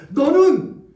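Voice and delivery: slow, rising proclamation, high and forceful; bold declaration. Acted. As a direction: declare it like David facing the giant. Sermon acting David's declaration. (골리앗 앞에서 다윗이 외치는 담대한 선포)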